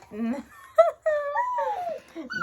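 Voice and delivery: shrill, loud laugh on the edge of tears; high laughing cry. Real, not acted. Laughing about painful IV.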